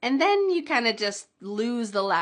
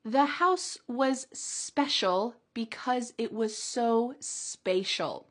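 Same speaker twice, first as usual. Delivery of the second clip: slow, careful teaching read; slow model sentence; slower than usual. Shown as a demonstration. Teacher reading the challenge sentence slowly to learners. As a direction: say it slowly so learners can follow. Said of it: "in a challenge sentence".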